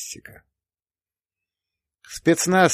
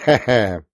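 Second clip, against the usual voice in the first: put-on old man chuckle. A narrator's reading. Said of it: "захохотали".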